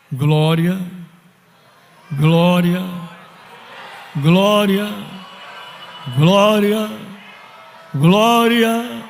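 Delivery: measured cries of glory, rising in pitch; building praise. Real, not acted. Preacher praising before the congregation, building praise. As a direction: let each exclamation climb above the last.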